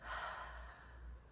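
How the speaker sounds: soft, airy sigh out; final deep exhale. Shown as a demonstration. Instructor ending the breathing round, soft exhaled sigh. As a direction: let the last breath go quietly. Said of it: "One more deep breath".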